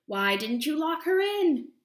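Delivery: high, loud shrieking rage; furious scream. Acted. Witch screaming at the gate for letting Sasha go. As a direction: scream it out, beside herself. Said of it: "she screamed".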